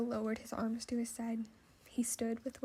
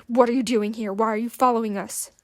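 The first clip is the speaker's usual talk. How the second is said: louder, furious, through gritted teeth; angry demand; 10 dB louder than usual. Acted. Will interrogates the metal creature, loud and furious. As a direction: bite each question off in rage. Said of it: "he said through gritted teeth".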